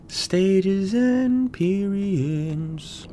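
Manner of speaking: high, very slow drawn-out "Stages and periods"; stretched sing-song. Acted. Echoing his own words to himself, high and drawn out. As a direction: hold every syllable of "Stages and periods" up high.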